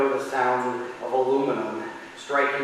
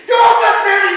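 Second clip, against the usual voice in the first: full-throated yell, much higher and louder; furious yell; 17 dB louder than usual. Retold or imitated. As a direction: scream it at him with everything. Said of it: "and I yelled".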